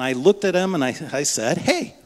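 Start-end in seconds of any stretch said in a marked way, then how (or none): 1.6-1.9 high, drawn-up friendly hey; bright retold greeting. Passenger hailing the Uber driver, high retold greeting. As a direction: pitch it well up to get his attention.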